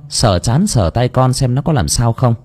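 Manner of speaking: plain reading voice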